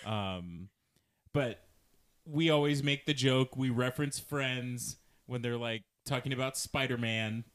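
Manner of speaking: ordinary delivery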